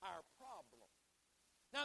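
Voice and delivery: usual speaking voice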